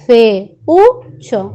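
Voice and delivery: FEUCHO broken into fe, ú, cho; "Feucho" split slowly with pauses; syllable by syllable. Shown as a demonstration. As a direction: sound out "Feucho" in three beats, U standing alone. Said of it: "Yo digo".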